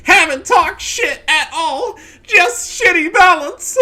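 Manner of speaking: very high, loud, slow mock wailing; mocking opponent's reply. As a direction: wail it like a sore loser. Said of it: "and there it is".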